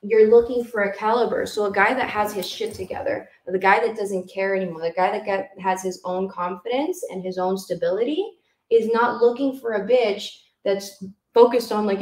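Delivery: normal talking voice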